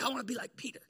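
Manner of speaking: hushed aside to the room; whisper. Acted. Preacher to congregation, whispered confession. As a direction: breathe it like a secret.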